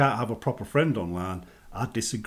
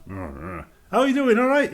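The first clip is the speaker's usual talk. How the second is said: cheery greeting; 11 semitones higher, 4 dB louder than usual. Retold or imitated. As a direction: drag the hello, then pop up bright. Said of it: "They smile".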